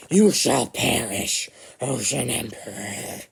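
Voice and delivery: slow, rasped-out threat from an ancient ghoul; ancient raspy voice. Acted. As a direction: drag out the curse like an old undead lord. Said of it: "in his ancient raspy voice".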